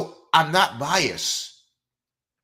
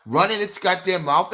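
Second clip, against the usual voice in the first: normal talking voice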